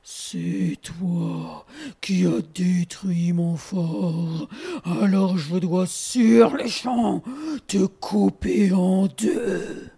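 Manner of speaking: low, slow menacing growl; menacing bear. Acted. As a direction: drag out each word like a threatening bear. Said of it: "l'ours cria".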